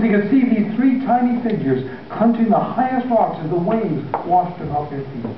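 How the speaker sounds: ordinary delivery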